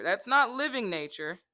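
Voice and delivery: ordinary talk, nothing marked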